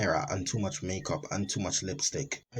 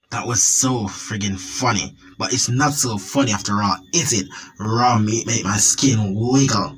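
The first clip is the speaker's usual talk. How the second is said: put-on gloating monster girl; 7 dB louder than usual. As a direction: savor every word like a killer. Monster girlfriend gloating over kill.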